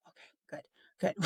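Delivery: breathy whispered relief. Retold or imitated. Parent relieved friends' teens are the same, whispering.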